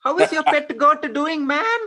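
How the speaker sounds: high-pitched cheer of a homesick caller finding family; excited recognition. Pretend. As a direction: greet a long-lost cousin with a burst of glee. Recognizing a relative on phone.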